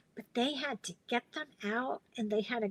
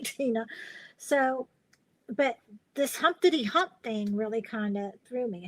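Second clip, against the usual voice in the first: ordinary delivery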